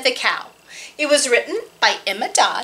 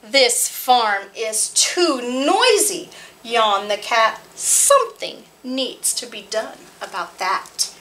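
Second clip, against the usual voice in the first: put-on lively read-aloud voice for small children; storytime narration. Librarian reading a farm picture book to children, lively.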